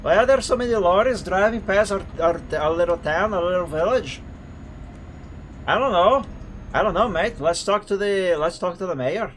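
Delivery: put-on Scottish accent. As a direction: play bewildered villagers with a put-on Scottish lilt. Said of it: "It is a nice Scottish accent".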